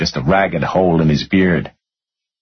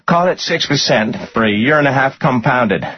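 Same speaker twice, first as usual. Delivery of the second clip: higher-pitched called correction; 8 semitones higher than usual. A narrator's reading. Jody correcting the amount owed, calling out. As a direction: call the figures across, pitched up. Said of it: "Jody called".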